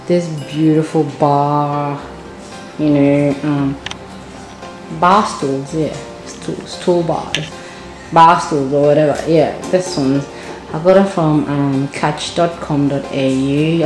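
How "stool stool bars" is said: ordinary talk, nothing marked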